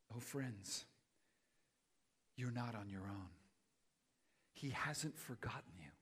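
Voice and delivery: hushed, low and pastoral; soft, unhurried words of consolation; tender reassurance. Real, not acted. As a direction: gently comfort someone who feels abandoned. Pastor reassures doubting congregation.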